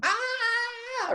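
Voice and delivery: high mock shriek of a scared toddler; imitating his daughter screaming at the dog, high mock shriek; imitated toddler scream. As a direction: squeal like a small child afraid of a puppy. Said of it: "she's screaming at him".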